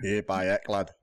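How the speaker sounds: Yorkshire example for a friend to copy; Yorkshire accent. Shown as a demonstration. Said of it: "even attempt a Yorkshire one".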